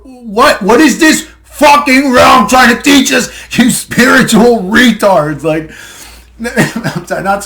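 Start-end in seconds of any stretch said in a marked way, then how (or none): 0.0-5.4 slow, shouted, furious rant; slow furious yell at the so-called spiritual crowd; angry shout. Real, not acted. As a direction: yell it out slowly at them, fed up. Ranting at believers.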